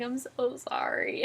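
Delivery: drawn-out, slow imitated apology; retold heartfelt apology. Vlogger retelling doctor's apology, drawn-out and sorry. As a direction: stretch out the sorry, imitating remorse. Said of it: "He felt so bad".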